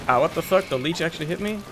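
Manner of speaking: usual speaking voice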